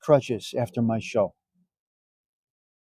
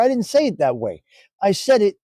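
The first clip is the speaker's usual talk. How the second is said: normal talking voice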